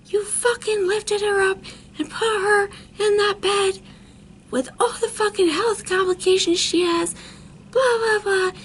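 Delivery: high-pitched angry rant; retold screaming. Dad berating his daughter's boyfriend, retold screaming. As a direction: re-enact a furious dad's tirade up high.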